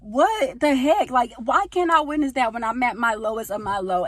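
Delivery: high, loud mock wail; re-enacted high-pitched protest; upset complaint to God. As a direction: plead loudly with the Lord, exasperated. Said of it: "it made me even more sad".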